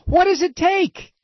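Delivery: high, outraged demand shouted at the sky; outraged yell. Real, not acted. Radio host yelling at absent politicians, outraged shout. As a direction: bellow the question in disbelief.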